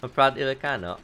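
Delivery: usual speaking voice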